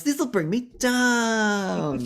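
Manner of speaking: high, very slow campy diva whine; hammed-up diva. Beef fussily refusing drugs from the manager. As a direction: stretch it out like a fussy rock diva.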